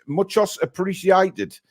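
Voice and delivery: a deliberately bad Cockney Spanish put-on; Cockney Spanish accent, badly faked for a laugh; mock Cockney Spanish. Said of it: "not sure what the hell accent that was supposed to be".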